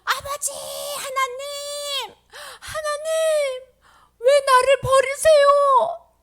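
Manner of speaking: high, drawn-out anguished shout; crying out. Acted. Jesus crying to the Father from the cross, anguished shout. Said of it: "갑자기 소리를 지르시더니".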